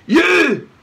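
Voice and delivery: loud high bark. A narrator's reading. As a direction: bark it accusingly. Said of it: "barked Eric".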